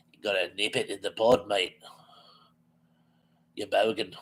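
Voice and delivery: lightly put-on Australian accent, low. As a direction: say it like a laid-back Aussie. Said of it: "in an Australian accent".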